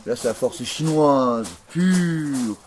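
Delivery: loud proclamation. Acted. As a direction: proclaim it loud and slow, rising high. Proclaims Chinese force loudly.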